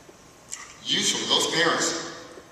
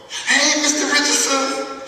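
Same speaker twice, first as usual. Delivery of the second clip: mock excited call, much higher and louder; 14 semitones higher, 6 dB louder, slower than usual. Former student spotting her old teacher, excited calling out. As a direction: call out his name delighted. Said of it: "she's excited".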